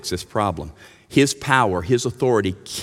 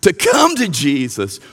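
impassioned preaching; 8 semitones higher, 3 dB louder than usual. Acted. Preaching with rising force.

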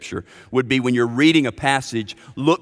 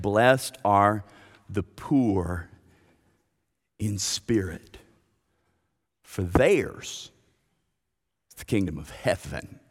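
low, hushed and measured; reverent, slow phrases with long pauses; solemn scripture reading; 4 semitones lower, 4 dB quieter than usual. Real, not acted. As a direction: read the verse softly, letting each phrase hang.

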